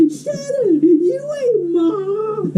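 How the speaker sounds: high, drawn-out bratty wail; whining kid brother. Retold or imitated. Scolded brother talking back to the older sibling, whining. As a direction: imitate a little brother whining in protest. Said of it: "my brothers always said that".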